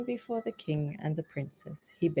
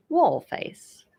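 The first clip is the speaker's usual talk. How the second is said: much higher, louder taunt; mocking name-calling; 9 semitones higher, 8 dB louder than usual. A narrator's reading. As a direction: fling the nickname. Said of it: "she called him".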